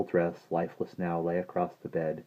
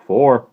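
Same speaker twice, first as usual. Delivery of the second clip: "Four" said loud and higher; firm correction; 6 semitones higher, 15 dB louder than usual. A narrator's reading. Timothy John corrects Maggie's count, "Four" loud and emphatic. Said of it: "Timothy John corrected her".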